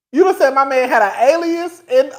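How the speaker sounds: ordinary talk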